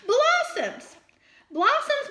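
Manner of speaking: high, loud delighted discovery; surprised reveal. A narrator's reading. Reveal: snow is blossoms.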